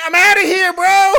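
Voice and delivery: high, loud, laughing exit; Shaggy voice. Pretend. Co-host leaving in a loud high Shaggy voice. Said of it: "Shaggy never said".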